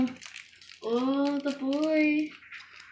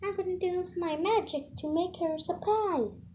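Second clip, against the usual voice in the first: usual speaking voice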